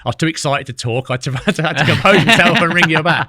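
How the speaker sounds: laughing quote. Retold or imitated. Retold friend breathless with excitement.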